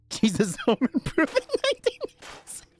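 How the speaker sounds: high, helpless laughing delivery; laughing through words. Real, not acted. Friend cracking up over a Jesus Home Improvement joke, laughing. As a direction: barely get it out for laughing.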